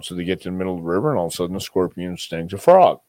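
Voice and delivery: ordinary delivery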